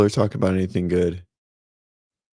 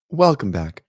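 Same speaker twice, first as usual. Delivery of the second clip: intro try; 5 semitones higher, 7 dB louder than usual. Shown as a demonstration. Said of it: "I gotta come up with, like, an intro".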